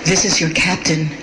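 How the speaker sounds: low, sultry captain announcement. Retold or imitated. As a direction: purr it like a seductive pilot. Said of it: "her sultry voice".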